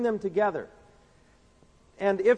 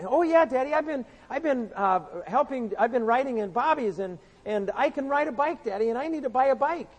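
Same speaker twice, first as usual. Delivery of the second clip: put-on little boy voice; 6 semitones higher than usual. Preacher voicing Johnny begging his daddy, higher child voice. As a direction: beg like a six-year-old wanting a bike.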